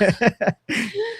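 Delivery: 높고 터지는 웃음; high burst of laughter; high squealing laugh. Real, not acted. Laughing at Carter's quote.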